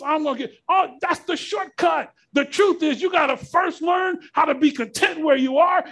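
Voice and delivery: fired-up pulpit preaching; preaching at full force. Real, not acted. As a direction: preach it hard to the congregation.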